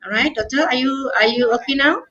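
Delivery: normal talking voice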